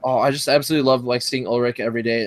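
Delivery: usual speaking voice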